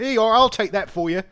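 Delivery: high, loud porter's call; pushy would-be porter. Acted. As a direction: shout it like a hustler grabbing the job.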